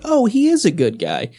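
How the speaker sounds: high mock sincere voice; sarcastic fan voice; mocking quote. Mocking Snape fans' view.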